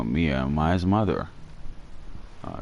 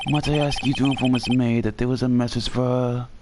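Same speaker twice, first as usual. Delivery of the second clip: put-on higher, polite old-fashioned bellboy; Alfred voice; 6 semitones higher than usual. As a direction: play an eager, chirpy butler-type bellhop. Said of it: "So I'm gonna do the Alfred voice".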